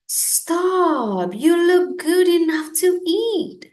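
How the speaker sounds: put-on high, loud boy's cry; a hungry little boy calling out; eager boy. A narrator's reading. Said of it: "said the boy".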